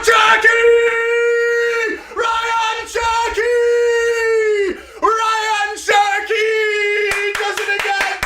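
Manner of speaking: ecstatic goal celebration. Real, not acted. Fan screaming as his team scores, ecstatic. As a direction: roar the scorer's name like a chant.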